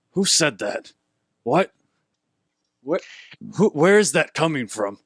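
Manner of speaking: lightly voiced confused half-orc; a touch of bewildered brute; puzzled orc. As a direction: call out, baffled by an unseen speaker. DM voices startled orc looking around.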